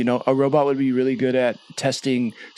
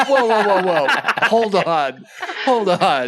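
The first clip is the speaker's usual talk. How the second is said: robot crossing guard; 4 semitones higher, slower than usual. Retold or imitated. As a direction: halt them like a jokey robot guard. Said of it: "just like robot crossing guards".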